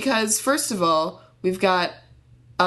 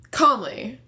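ordinary talk

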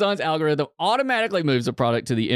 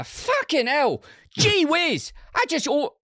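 very high, put-on British accent on Gee whiz; slower than usual. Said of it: "in a British accent".

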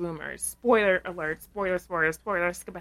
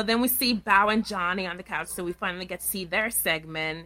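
normal talking voice

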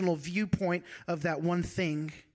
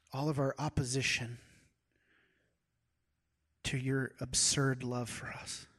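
closing prayer; 3 semitones lower, 4 dB quieter than usual. Acted.

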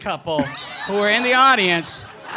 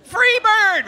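high, loud bellow of a heckler; drunk heckler yell; 8 dB louder, much slower than usual. Retold or imitated. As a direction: shout it from the back of the crowd. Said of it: "the whole show".

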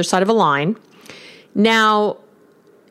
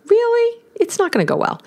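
incredulous. Real, not acted. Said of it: "incredulous reaction".

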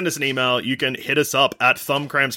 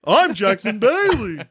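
high, slow cartoon send-up; Jackson Bailey; 7 semitones higher, slower than usual. Host parodies co-host introducing himself, high mock voice. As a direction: announce the name in a silly high voice. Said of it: "is that you doing my voice?".

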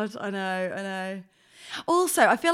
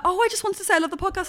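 quick fan; 7 semitones higher, much faster than usual. Retold or imitated. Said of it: "she was like".

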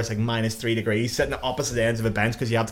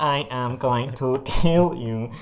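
slow, drawn-out spooky threat voice; mock death threat; much slower than usual. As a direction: threaten slowly, word by word, as a prank through a wall. Retold scare through the wall.

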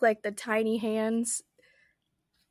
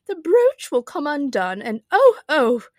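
loud, high lament breaking into cries; groaning Queen; 4 semitones higher, 8 dB louder than usual. A narrator's reading. The Queen dreads her brooch coming undone, groaning loudly. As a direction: build to a loud, pained oh. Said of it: "the poor Queen groaned".

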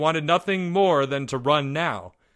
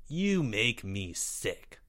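slow, soft contempt; disgusted; 8 dB quieter, much slower than usual. A narrator's reading. Greg responds to Albert's confession, quietly disgusted.

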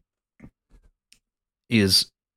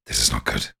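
very low, hushed growly whisper; low conspiratorial whisper; whispered warning; 13 semitones lower than usual. Acted. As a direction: warn under your breath, deep and secretive. Proserpine warns secretly behind a curtain.

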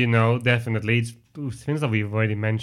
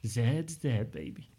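put-on soft, dropping to a murmur on baby; lightly voiced Bruce Willis, quiet and cool; acted Bruce Willis line; 10 dB quieter, slower than usual. Host quoting Bruce Willis for the quiz, hushed and cool. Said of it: "the one memorable line he has".

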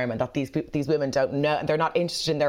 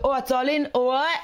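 higher-pitched put-on London accent; hammed-up London greeting; mock London accent; 7 semitones higher than usual. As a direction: imitate a newly acquired London accent. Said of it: "Were you in London recently?".

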